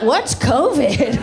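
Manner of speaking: slow, dopey clueless voice; dumb crowd. Retold or imitated. Mocking the Florida crowd, slow and clueless. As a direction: drag it out like you've never heard of it. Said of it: "This whole crowd's like".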